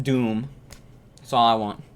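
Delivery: usual speaking voice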